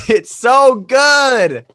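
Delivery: loud, high, drawn-out wail of praise; overwhelmed gushing. Real, not acted. Fan overcome by the film's ending, loudly gushing. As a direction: gush at full volume, stretching the words.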